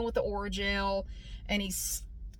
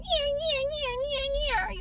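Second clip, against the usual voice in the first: much higher, dismissive yeah-yeahs; mimicking the dentist. Teller mimicking the dentist brushing her off, high.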